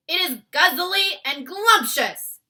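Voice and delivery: loud, high greedy giant shout. A narrator's reading. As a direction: boom it out gleefully. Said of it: "shouted the blood bottler".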